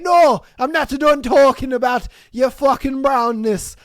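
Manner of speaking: loud, high hammed-up Scottish rant; mock Scottish accent. Scotsman refusing to change subject, loud mock Scottish accent. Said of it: "Happened in Scotland".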